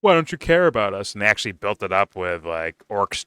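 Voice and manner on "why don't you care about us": high, louder whining plea to daddy; mock-whine like a wounded child; whiny orc. Vlogger mocking the orc pleading with Sauron, high whine. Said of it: "He was like".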